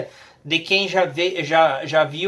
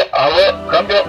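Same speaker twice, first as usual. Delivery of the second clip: over walkie talkie; 7 dB louder, faster than usual. Real, not acted. Voice coming through the radio.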